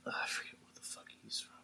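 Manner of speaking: whispered under breath; whispered aside. Real, not acted. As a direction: mutter it in a whisper. Muttered aside to himself.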